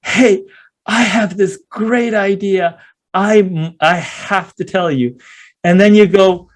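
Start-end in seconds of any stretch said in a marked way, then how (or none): 0.0-5.2 high, bursting with excitement; excited wake-up plea. Pretend. Waking a sleeping friend at 3 AM, eager and excited. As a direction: blurt it out, dying to share the idea. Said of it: "you shake them and say".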